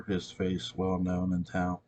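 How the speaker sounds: ordinary delivery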